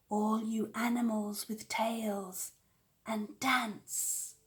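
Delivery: slow, voiceless whisper; whispered spell. A narrator's reading. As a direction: whisper it under your breath like a charm. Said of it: "Wanda whispered beneath her breath".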